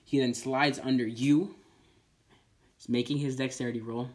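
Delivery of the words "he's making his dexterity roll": usual speaking voice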